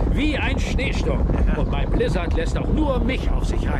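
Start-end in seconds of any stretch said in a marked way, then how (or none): none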